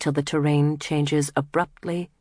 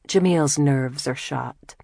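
ordinary delivery

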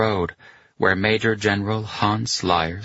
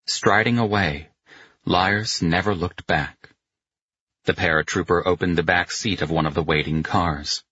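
ordinary delivery, nothing marked

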